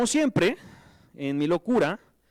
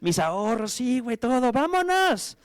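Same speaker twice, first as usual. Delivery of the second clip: higher and rising, ending in a high whoop on vámonos; excited go-for-it; 8 semitones higher than usual. Retold or imitated. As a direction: fire yourself up to bet everything. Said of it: "Yo dije". (entusiasmo creciente que termina en un grito agudo)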